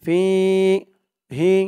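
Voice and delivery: ফিহি sung slowly with the হি held long; long fihi. Shown as a demonstration. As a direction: stretch ফিহি, hold the ending. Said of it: "জেরের পরে ইয়া সাকিন এক আলিফ টান".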